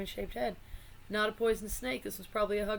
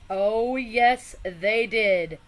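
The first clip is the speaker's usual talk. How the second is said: thrilled; 10 dB louder, much slower than usual. Real, not acted. Vlogger thrilled to show viewers the Poe card, loud and excited. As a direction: shout your joy at the card.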